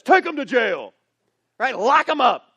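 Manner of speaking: commanding order. Retold or imitated. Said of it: "he says".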